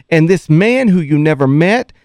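ordinary delivery

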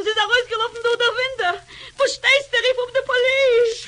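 tearful panic, rising; mother crying that her daughter has run away. Acted. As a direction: sob the words out. Mother discovering daughter ran off.

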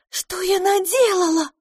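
испуганный вскрик, гораздо выше; закричать от ужаса над прудом; в ужасе. A narrator's reading. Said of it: "в ужасе закричала Пипина". (крик ужаса, очень высоко)

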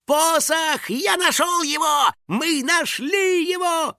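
high, squealing cries of triumph; jubilant. A narrator's reading. House spirit finding the treasured staff, jubilant. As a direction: exult at the top of a high voice. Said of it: "возликовал Финик".

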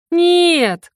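high, loud, drawn-out wail of no; moaned cry. A narrator's reading. Falling heroine to the cliff air, despairing moan. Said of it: "простонала я".

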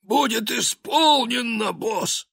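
high wheezy eager shout. Acted. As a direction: wheeze like a keen minion. Trash man answers.

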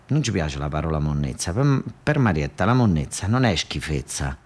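normal talking voice

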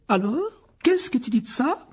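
high, challenging demand; exclaiming. Acted. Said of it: "s'exclama madame Wesley". (sommer de répondre, voix aiguë)

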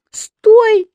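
very high, loud wailed plea; tearful cry. A narrator's reading. Еля stopping papa at the bed, tearful cry. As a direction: cry out to stop him. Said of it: "заплакала Еля".

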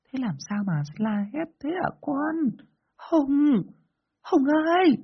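very high, put-on granny voice; worried grandmother. A narrator's reading. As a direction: call out to a grandchild in a high old-woman voice. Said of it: "Có lần bà nội anh hỏi".

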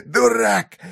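high, sharp mocking cry; high mocking shout. A narrator's reading. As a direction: fling the insult high. Dying man mocks, repeated.

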